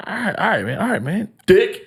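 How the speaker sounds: high, quick all right man; nervous retreat. Retold or imitated. Teller backing away from the tow guy, high nervous retreat.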